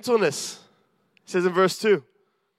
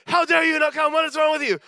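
higher-pitched scolding, half-acted; mock berating; 8 semitones higher than usual. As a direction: play the friend who scolds down the phone, pitch up. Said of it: "Do we call and berate them".

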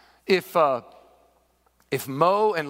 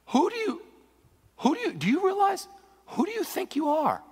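high-pitched, incredulous indignation; outraged; 11 semitones higher than usual. Acted. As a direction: challenge him in offended disbelief, without shouting. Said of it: "They were outraged".

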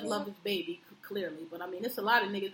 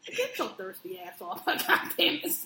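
mock scolding; 6 semitones higher than usual. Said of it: "I was like".

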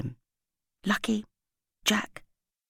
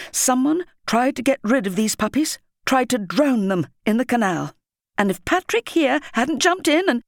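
higher and louder, anger shaking the words; trembling with anger; 5 semitones higher, 4 dB louder than usual. A narrator's reading. Headteacher telling the whole school about drowned puppies, angry. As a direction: hold back fury as you address them. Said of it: "Her voice was trembling with anger".

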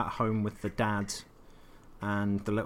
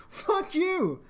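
mock indignant retort; 10 semitones higher than usual. Player snapping back at android.

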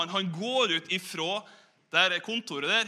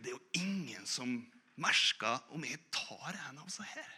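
hushed inner thought; 5 semitones lower, 9 dB quieter than usual. Acted. Inner thought of tempted cook.

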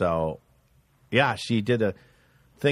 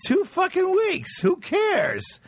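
high, incredulous outburst; mock fed-up argument; exasperated boyfriend; 12 semitones higher, slower than usual. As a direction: brush off her worry in exasperation. Retold argument with girlfriend.

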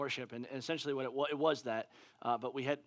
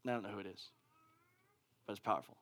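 ordinary delivery

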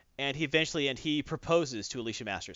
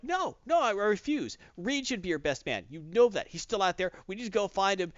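upset refusal; 7 semitones higher than usual. Retold or imitated. Johnny refuses.

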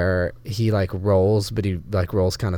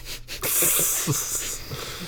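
breathy laugh; 6 semitones higher, 13 dB quieter than usual. Real, not acted. Laugh after in-character line.

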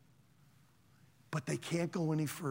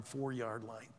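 ordinary talk, nothing marked

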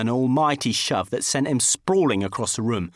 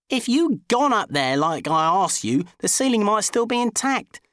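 higher-pitched reproach; arguing; 7 semitones higher than usual. A narrator's reading. Housemate blames him in a growing row, reproachful. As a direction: throw the blame back, voice rising. Row over the ceiling hole.

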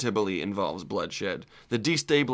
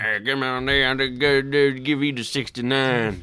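put-on louder, slower swamp person accent drawl; 3 dB louder than usual. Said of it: "I'm trying to talk like a swamp person".

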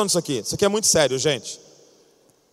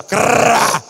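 loud rasping imitation of a chair dragged on the floor; imitated chair-dragging screech; chair scrape noise; 3 semitones higher, 6 dB louder than usual. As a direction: make the harsh scrape of a chair shoved back. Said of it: "arrastando a cadeira assim".

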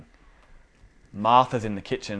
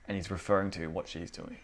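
ordinary talk

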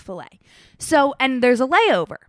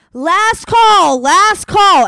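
higher, louder announcer's call; 9 semitones higher, 10 dB louder, much slower than usual. Retold or imitated. Airport staff calls out for an abandoned bag, loud announcement. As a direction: call it across the terminal.

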